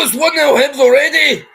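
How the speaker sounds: shouted high and loud, leaning on one; goal call. Acted. Commentator screaming the score right after the goal. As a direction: yell the score like the stadium just exploded.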